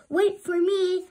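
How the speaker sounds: high, loud call; crying out. A narrator's reading. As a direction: call out chasing him. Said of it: "cried Sebastian".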